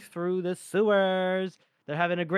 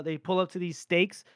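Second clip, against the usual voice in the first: usual speaking voice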